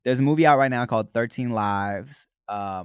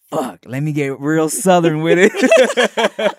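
determined; 4 semitones higher, 9 dB louder, slower than usual. Retold or imitated. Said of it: "me just trying to be like".

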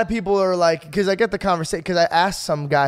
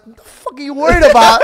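higher, louder scoffing father; exasperated dad; 10 semitones higher, 10 dB louder than usual. Retold or imitated. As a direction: scoff at the son's worry. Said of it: "He goes".

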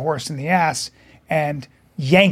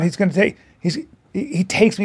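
ordinary talk

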